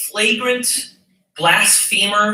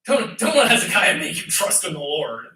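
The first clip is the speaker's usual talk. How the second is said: scornful brushing-off tone; mock him with contempt; dismissive; 2 semitones lower than usual. Enemy envoy sneering at Hezekiah's people, dismissive. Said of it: "You can hear the dismissiveness".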